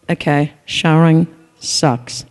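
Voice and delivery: slow, loud, drawn-out groan of disgust; groaning complaint. Real, not acted. Telling the audience about showering, slow loud groan. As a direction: drag it out like a dreaded chore.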